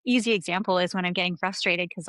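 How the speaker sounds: ordinary talk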